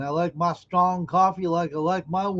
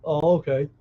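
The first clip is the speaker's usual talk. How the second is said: normal talking voice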